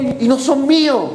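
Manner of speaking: mock-anxious high voice; retold protest. Acts shaken woman refusing found money.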